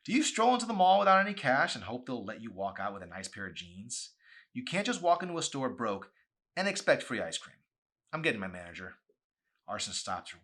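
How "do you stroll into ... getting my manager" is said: angry scolding. Acted. Chelsea scolds Mandy.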